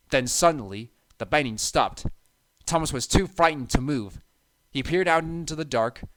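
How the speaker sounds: ordinary delivery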